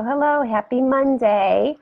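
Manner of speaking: usual speaking voice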